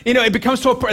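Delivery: ordinary talk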